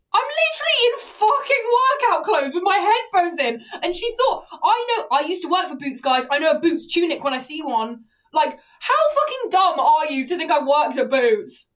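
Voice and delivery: high, loud, exasperated outburst; indignant rant. Real, not acted. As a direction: let the outrage pour out at full volume. Rants about being mistaken for staff.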